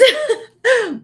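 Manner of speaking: a sudden high, loud laugh; high burst of laughter. Real, not acted. Vlogger laughs to viewers before trying chips, high squealing laugh. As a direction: break into a bright squeal of laughter.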